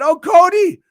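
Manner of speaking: loud, high burst on the name; excited call. Acted. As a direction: announce the name like a ring call. Excited announcement.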